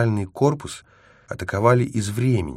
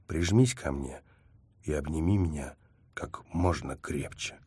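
soft, intimate; 3 semitones lower, 7 dB quieter than usual. A narrator's reading. Intimate urging to hold close.

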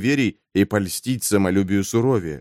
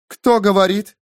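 higher, louder startled question; startled call; 7 semitones higher, 4 dB louder than usual. Acted. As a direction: call out sharply to an unseen voice. Startled knight asks unseen voice.

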